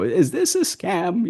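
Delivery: put-on high, slow, rising old-lady question. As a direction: squeak it out like a suspicious old lady. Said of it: "have an old lady and she would go".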